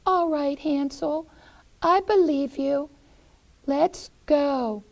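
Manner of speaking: put-on high girl voice; little sister. Sister agrees.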